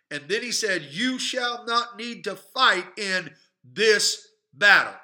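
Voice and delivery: slow, emphatic scripture preaching. Acted. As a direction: proclaim the verse to the church.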